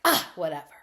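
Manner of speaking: loud scoff, then dismissive; mock huffy brush-off; exasperated huff. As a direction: burst out in acted disgust. Voicing rejected ex's huff.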